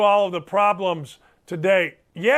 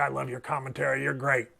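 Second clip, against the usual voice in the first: soft, lower conciliatory voice; retold backing down; 5 semitones lower, 8 dB quieter than usual. Host imitating a heckler who relents, soft and conciliatory. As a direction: play a heckler gone meek.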